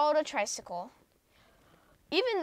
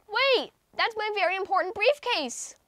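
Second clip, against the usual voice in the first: higher, raised call; 8 semitones higher than usual. Acted. Mister Brown calls after the ice cream seller, raised call. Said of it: "called Mister Brown".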